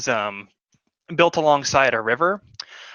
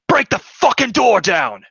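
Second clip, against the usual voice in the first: loud, higher barked order; soldier's shout; 9 semitones higher, 6 dB louder than usual. Acted. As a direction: yell it through the storm at your men. Soldier orders door broken.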